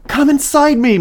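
high loud desperate plea; pleading. Acted. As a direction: beg at the top of your voice. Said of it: "Miranda pleaded".

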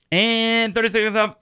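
ordinary talk, nothing marked

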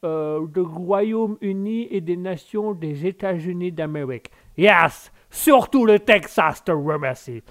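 put-on drawling Texan accent in French; American accent. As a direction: play a slow, hammed-up American speaking French. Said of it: "de l'Américain".

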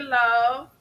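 ordinary talk, nothing marked

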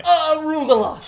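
high, loud mock cry of arugula again; exaggerated exclamation. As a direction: belt it out dramatically.

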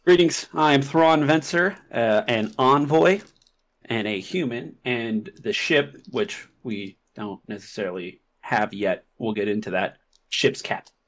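character voice. Acted. Player introduces character in voice.

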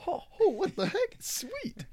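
high, loud delighted shriek; excited squeal. Retold or imitated. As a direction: squeal with surprised joy. Retelling own excited climb.

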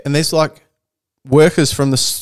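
ordinary delivery

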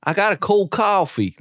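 high-pitched attempted Jersey accent. Shown as a demonstration. Host practising coffee lines in Jersey accent. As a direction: stretch the aw like a Jersey boy. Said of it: "I'm trying to say coffee, but they say".

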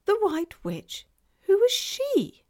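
very high, slow question; baffled child. A narrator's reading. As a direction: ask in a wondering little-girl voice. Child asks who the witch is.

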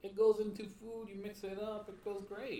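loud and high, selling tofu with gusto; excited. Real, not acted. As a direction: cheer it like a tofu fan.

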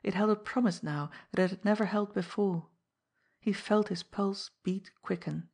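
ordinary delivery, nothing marked

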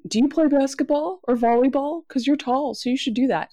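a touch of chirpy small talk; mock nosy adult. Voicing nosy adults' stock question.

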